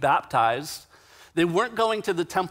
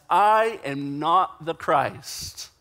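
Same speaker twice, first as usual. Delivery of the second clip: a slow, weighty "I am not the Christ"; slow, firm confession leaning on "I"; emphatic denial; 4 dB louder, much slower than usual. Retold or imitated. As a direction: hit "I" hard, then space out "not the Christ". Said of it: "he answers very emphatically".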